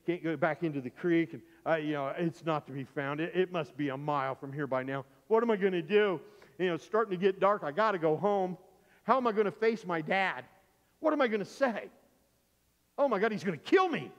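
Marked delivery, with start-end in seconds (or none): 5.3-14.1 loud, high-pitched desperate fretting; panic. Retold or imitated. Boy dreading to face his dad, panicking. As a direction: act out mounting panic, voice climbing. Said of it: "I panic".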